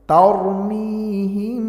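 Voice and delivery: তারমিহিম with the মিম held long and humming through the nose; nasalized ghunna. Shown as a demonstration. Teacher shows students the ghunna on তারমিহিম, long nasal hum. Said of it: "তাহলে সেখানে গুণনা হবে".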